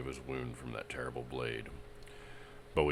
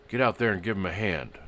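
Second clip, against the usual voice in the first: louder, commanding bark; ordering; 6 semitones higher, 9 dB louder than usual. Acted. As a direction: order him out. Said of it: "Jay Turner ordered".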